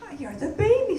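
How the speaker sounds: ordinary delivery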